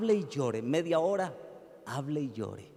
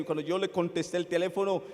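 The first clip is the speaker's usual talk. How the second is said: normal talking voice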